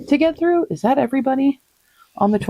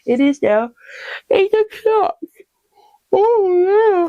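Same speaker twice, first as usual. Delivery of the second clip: dramatic dismay; 13 semitones higher, much slower than usual. Real, not acted. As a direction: wail about the late hour. Dramatic reaction to the late hour.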